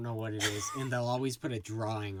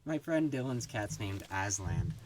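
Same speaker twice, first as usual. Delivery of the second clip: ordinary talk, nothing marked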